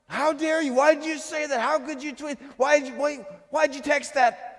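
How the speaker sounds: high, loud outraged outburst; freaking out. Acted. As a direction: fire off indignant accusations in an acted freak-out. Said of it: "We freak out".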